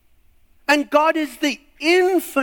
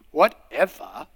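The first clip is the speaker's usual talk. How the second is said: put-on sulky teen; 8 semitones lower than usual. Sulky reply to sister.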